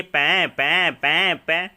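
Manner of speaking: drawn-out mocking imitation of crying; mimicked baby wail. Father mimicking his baby's ear-splitting cries, mock wailing. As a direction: stretch out each bleat like a wailing baby. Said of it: "ऐसे कि कान पचा देती है".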